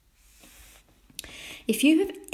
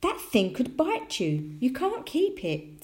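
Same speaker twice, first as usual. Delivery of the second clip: high, slow motherly warning; worried disapproval; 7 semitones higher than usual. A narrator's reading. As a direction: forbid it with drawn-out alarm. Mother forbids keeping a mouse.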